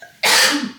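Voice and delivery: loud breathy hacking cough; harsh cough. Real, not acted. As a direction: hack it out from a raw throat. Sick vlogger coughing.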